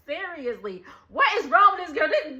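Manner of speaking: ordinary delivery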